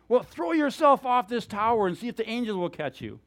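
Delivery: high, loud tempter's challenge; goading dare. Retold or imitated. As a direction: egg him on to do it. Preacher voices Satan tempting Jesus.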